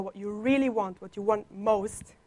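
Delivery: normal talking voice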